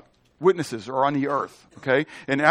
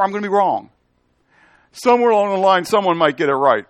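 loud, high-pitched sermon delivery; full-force preaching; 6 semitones higher, 5 dB louder than usual. Real, not acted. Preacher telling the congregation nobody knows the hour, preaching forcefully. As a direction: preach it hard, voice lifted.

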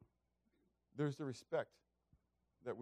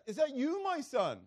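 high calling voice to the hillside; a king calling out to his rival; crying out; 13 semitones higher, 8 dB louder, slower than usual. Retold or imitated. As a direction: call out to find him across the distance. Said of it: "he's crying out".

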